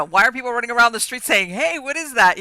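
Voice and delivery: mock alarmed bystanders. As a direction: call it out like startled passers-by. Acts out alarmed people in street.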